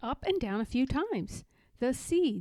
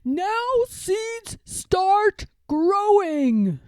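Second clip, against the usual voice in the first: high, loud, slow yell; shouted; 8 semitones higher, 9 dB louder, much slower than usual. A narrator's reading. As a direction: bellow it at the ground so the seeds hear.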